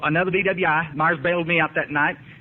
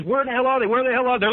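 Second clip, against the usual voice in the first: mock kid frustration; 6 semitones higher than usual. Kids hunting Easter eggs.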